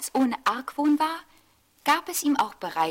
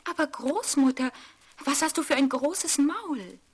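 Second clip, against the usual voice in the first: put-on higher, wondering little girl; a curious child asking; 7 semitones higher than usual. A narrator's reading. Rotkäppchen voice. (Rotkäppchen fragt erstaunt mit hoher Mädchenstimme)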